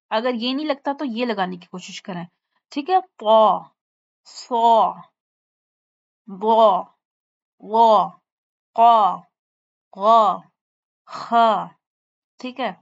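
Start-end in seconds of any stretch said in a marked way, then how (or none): 3.0-11.7 طا, صا, ضا, قا said heavy and louder; the seven heavy letters, said thick. Shown as a demonstration. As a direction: read each letter thick and full. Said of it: "یہ حروف موٹے پڑھے جاتے ہیں".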